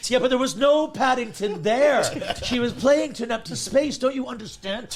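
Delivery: high, loud protest; mock outrage. Defending a failed Paddington sketch to the co-host, mock outraged. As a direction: protest loudly in hammed-up exasperation.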